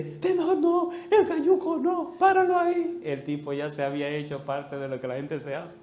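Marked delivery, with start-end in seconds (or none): 0.0-3.0 high, loud laughing yell to stop; shout. Retold or imitated. Boy shouts at Tenor not to throw the bully in, high laughing yell. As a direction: holler at him to stop, half laughing. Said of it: "Y grité".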